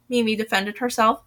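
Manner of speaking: ordinary delivery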